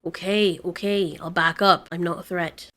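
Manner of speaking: low, soft surrender; placating. Acted. Range yielding to mate's plea, low placating voice. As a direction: back off quietly, voice sinking.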